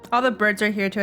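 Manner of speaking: usual speaking voice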